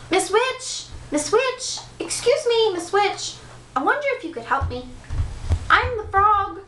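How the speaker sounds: high, polite, timid calling; timid prince voice. Acted. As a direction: call out nervously in a high voice. Prince calling third witch.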